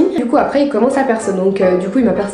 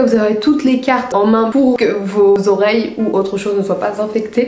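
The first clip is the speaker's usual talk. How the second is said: ordinary delivery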